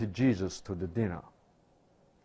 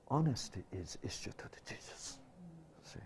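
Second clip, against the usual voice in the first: hushed whispered emphasis; 5 semitones higher, 10 dB quieter than usual. Acted. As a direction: drop to a breath for the key word. Whispered key point in sermon.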